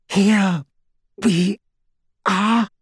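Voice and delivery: straining with effort, pausing after each word; through gritted teeth. A narrator's reading. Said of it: "she said, between gritted teeth".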